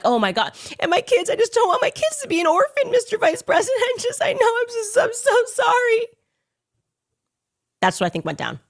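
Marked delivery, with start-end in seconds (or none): 0.0-6.1 very high, whimpering mock plea; mocking fake distress. Host imitating the pleading phone call, high and whimpering. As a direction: overplay a teary phone plea. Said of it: "he was probably like".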